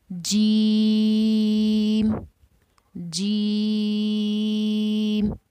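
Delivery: जीम drawn out long and loud like Quran recitation; Arabic letter demo. Shown as a demonstration. Teacher demonstrating the letter जीम to students, long and loud. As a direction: hold जीम long and full, as a tajweed teacher. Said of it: "जीम की अदायगी किस तरह से होगी?".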